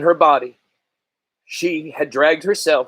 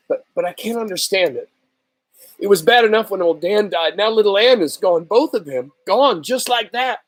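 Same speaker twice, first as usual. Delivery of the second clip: put-on higher, pleading boy's voice, close to tears; a boy who can't understand his loss; upset boy; 6 semitones higher than usual. Grieving boy protests.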